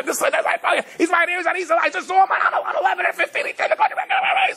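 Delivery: hammed-up panic about the bomber on the corner, shouted high with laughter; mock panic. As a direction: ham up a frantic New Yorker spotting the bomber. Mimicking panicked New Yorkers.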